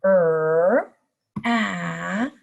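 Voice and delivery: "Er" then "at" held apart slowly; stretched-out sounds. Shown as a demonstration. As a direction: segment the word into sounds. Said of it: "Let's stretch the sounds out".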